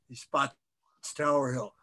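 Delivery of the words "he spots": ordinary delivery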